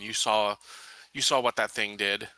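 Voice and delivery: ordinary talk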